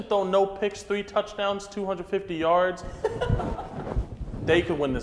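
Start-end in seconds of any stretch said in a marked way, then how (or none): 2.9-4.4 breathy laugh. Real, not acted. Laughs at bold prediction.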